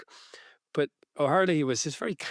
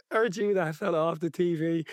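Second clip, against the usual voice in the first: lightly imitated thick working-class Dublin accent; a hint of a Dublin cabbie spotting a celebrity; 4 semitones higher than usual. Taxi driver recognising Bill, light Dublin accent. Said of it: "really, like, thick working class, I guess, Dublin accent".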